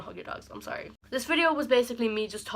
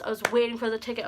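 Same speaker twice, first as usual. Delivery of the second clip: usual speaking voice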